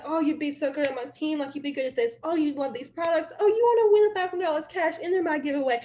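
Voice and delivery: bubbly, eager sales pitch; excited. Pretend. Recruiter acts out messaging prospects, excited and eager. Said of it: "when you're excited".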